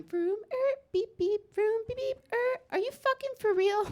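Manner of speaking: imitating a small boy; child car noises. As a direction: play like a toddler with toy cars. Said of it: "He's going".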